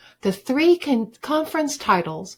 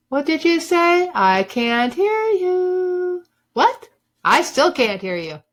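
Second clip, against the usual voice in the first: mock can't-hear-you taunt; 8 semitones higher than usual. A narrator's reading. Taunting the doubters.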